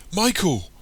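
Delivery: high, loud repeated calls; urgent shouting. A narrator's reading. Tara rushes to collapsed Michael, shouting his name. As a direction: shout the name in rising alarm.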